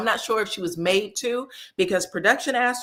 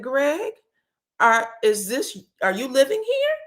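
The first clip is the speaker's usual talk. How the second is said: mock surprise; 6 semitones higher than usual. [name] surprised at Greg.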